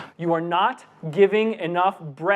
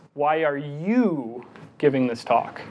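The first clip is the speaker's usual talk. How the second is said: "you" stretched out and pushed; stress on YOU; 3 semitones lower, slower than usual. Shown as a demonstration. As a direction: linger on "you", then drop for the rest. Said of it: "how do I get that distinction".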